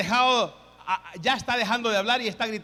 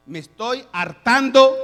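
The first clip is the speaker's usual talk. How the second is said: God shouting; 9 dB louder than usual. Acted. God voiced shouting at humanity.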